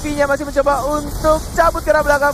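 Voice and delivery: loud, fast play-by-play; esports caster. Real, not acted. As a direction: call the kill with energy. Live play-by-play of fight.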